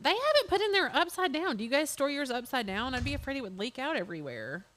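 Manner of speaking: usual speaking voice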